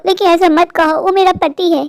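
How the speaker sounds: usual speaking voice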